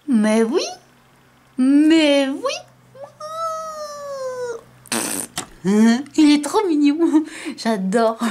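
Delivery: high squealing giggles; giggly cutesy voice. Real, not acted. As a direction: squeal and coo with delight. Gushing over cute Pokémon card.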